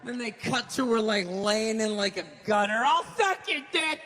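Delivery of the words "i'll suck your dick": mock addict voice. Voicing addict in gutter.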